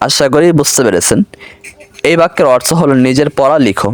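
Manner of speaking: usual speaking voice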